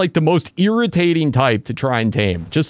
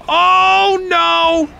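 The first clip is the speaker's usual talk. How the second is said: dismayed shout; 5 dB louder than usual. Real, not acted. Second cry, same danger.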